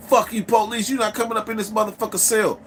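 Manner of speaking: high-pitched yelling-at-police act; acted defiance. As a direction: stall them with showy outrage. Acted defiance at cell door.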